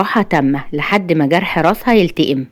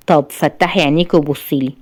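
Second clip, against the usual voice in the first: usual speaking voice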